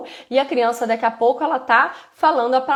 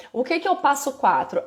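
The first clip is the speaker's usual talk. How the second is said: ordinary delivery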